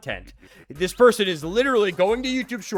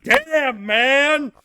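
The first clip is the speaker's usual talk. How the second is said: much higher, cartoonish stunned voice; Luigi; 10 semitones higher, 7 dB louder, much slower than usual. Luigi stunned watching Mario and Donkey Kong, high cartoon voice. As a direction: squeak out a dazed reaction. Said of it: "Luigi's just like".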